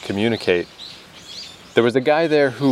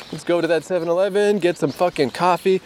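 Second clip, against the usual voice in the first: much higher, fast and eager; excited rally; 9 semitones higher than usual. Real, not acted. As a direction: pump yourself up for coffee. Rallying himself to go for coffee.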